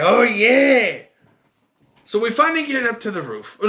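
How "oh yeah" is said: cheering. Retold or imitated. Viewer cheers the pie hit.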